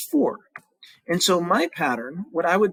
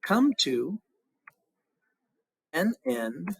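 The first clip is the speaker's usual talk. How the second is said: counting syllables; much slower than usual. Shown as a demonstration. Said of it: "I've got new slash marks here".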